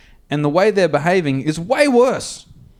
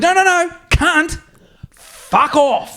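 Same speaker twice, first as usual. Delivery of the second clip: high, slow, louder no no no; mock angry dismissal; 14 semitones higher, 3 dB louder, much slower than usual. Dismissing the woman driver a second time, mock furious. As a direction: drag the nos out and shut her down. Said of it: "and I said".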